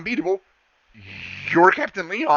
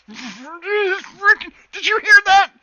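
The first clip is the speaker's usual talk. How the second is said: much higher, louder fangirl squeal; starstruck excitement; 8 dB louder, much slower than usual. Acted. The girl squeals at Leon about the Warforged. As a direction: squeal it at your friend. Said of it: "She goes".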